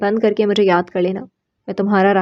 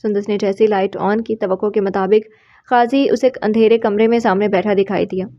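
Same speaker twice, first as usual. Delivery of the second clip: usual speaking voice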